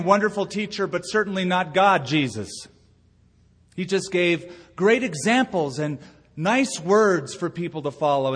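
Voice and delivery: ordinary talk, nothing marked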